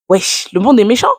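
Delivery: high, loud "wesh" outburst; exclaimed realisation. Real, not acted. (exclamation soudaine, plus aiguë et plus forte)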